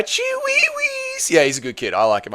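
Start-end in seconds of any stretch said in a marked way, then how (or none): 0.0-1.3 very high, drawn-out silly squeal; slow, squeaky falsetto name call; playful squeal. Pretend. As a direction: stretch the name out in a tiny falsetto. Playfully echoes a funny name.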